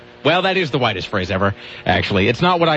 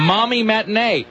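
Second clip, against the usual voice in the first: mock excited exclaim; 7 semitones higher than usual. Real, not acted. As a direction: read with an exclamation mark. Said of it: "Exclamation mark".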